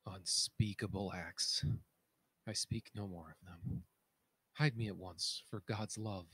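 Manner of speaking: very soft faint plea; faint broken plea. Acted. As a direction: barely voice it, as if from far off. King pleads to be hidden.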